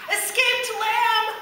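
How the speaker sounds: high, slow called answer; calling out. A narrator's reading. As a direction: shout the news down the street. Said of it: "someone called".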